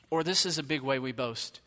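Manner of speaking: usual speaking voice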